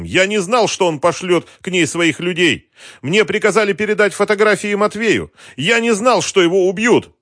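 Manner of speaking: high, loud, desperate self-defence; insistent pleading. A narrator's reading. Captive swears to his interrogator he knew nothing, high and insistent. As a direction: keep protesting innocence, pitched right up. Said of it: "твердил Вадим".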